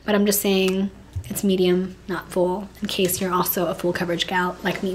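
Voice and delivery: ordinary delivery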